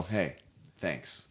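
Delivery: deadpan quiet thanks. Retold or imitated. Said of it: "they go".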